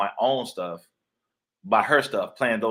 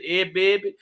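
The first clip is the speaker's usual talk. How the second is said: put-on New Orleans accent; 9 semitones higher, 3 dB louder, slower than usual. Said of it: "She had that accent".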